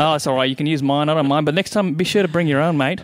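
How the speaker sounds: high, lively mock Australian voice; Australian accent. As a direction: play Fred the Australian at a party. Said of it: "Fred the Australian".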